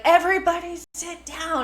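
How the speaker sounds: loud, high teacher shout; teacher voice yell. Retold or imitated. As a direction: yell it like a kids' ballet teacher. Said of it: "I had to put my teacher voice on".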